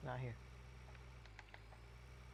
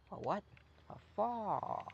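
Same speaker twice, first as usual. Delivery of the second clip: a much higher, nasal-free drawl, "fire" said as "far"; mock Southern exchange; 7 semitones higher, slower than usual. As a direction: play the confused country neighbor. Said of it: "instead of saying it like that".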